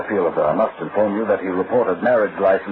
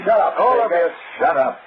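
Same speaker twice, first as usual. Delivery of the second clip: angry shout; 9 semitones higher than usual. Acted. Detective silencing the bickering suspects, angry shout. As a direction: cut them all off with a furious yell.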